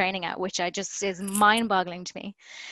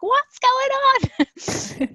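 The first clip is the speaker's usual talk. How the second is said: mock-scandalized mom, much higher and louder; mom barging in; 8 dB louder, much slower than usual. Mom walking into the bedroom, a shrill high startled mock mother. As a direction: play the mother catching her kid mid-promo.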